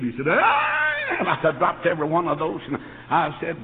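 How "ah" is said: high loud yelp of surprise; startled cry. Retold or imitated. Soda jerk shocked at the news, high yelp. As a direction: shriek in shock. Clerk startled, drops cups.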